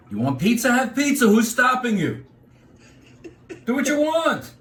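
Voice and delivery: high, snappy, dismissive; brusque New Yorker. Retold or imitated. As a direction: brush off the pizza idea. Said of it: "if I were to say something on the East Coast".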